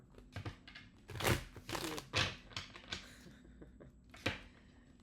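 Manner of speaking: airy laugh after a joke; long breathy laugh. Real, not acted.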